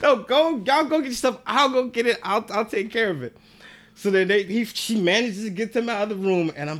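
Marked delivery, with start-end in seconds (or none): 0.0-3.3 higher hurried insistence; flustered girl again. Retold or imitated. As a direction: rush them out, stalling. Girl shooing parents again.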